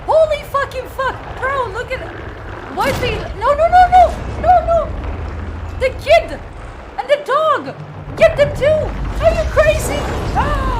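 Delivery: yelling high and loud in shock; panicked shouting. Real, not acted. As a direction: scream at the screen in horror. Cutscene horror, family left behind.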